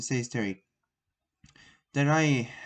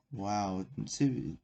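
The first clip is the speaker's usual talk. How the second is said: usual speaking voice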